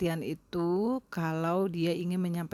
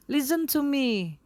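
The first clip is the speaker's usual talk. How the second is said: higher, louder, slow order to listen; firm, loud call; 8 semitones higher, 5 dB louder than usual. Shown as a demonstration. Ignored speaker to crowd, loud firm demand. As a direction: demand they finally listen. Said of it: "agak lebih keras".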